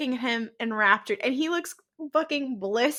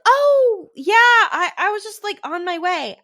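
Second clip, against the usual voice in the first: high, loud caught-out stammer; guilty high-pitched fumble, caught red-handed; flustered excuse; 8 semitones higher, 8 dB louder than usual. Retold or imitated.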